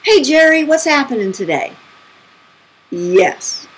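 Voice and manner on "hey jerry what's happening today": high, raised call across a pool; mock yell, starting high and loud; retold yell. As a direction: imitate a group calling out hello. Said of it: "the people in the pool yell to him".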